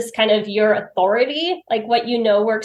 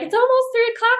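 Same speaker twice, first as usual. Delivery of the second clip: mom's hurry-up reminder; 13 semitones higher, faster than usual. Retold or imitated. Mom hurrying her, retold.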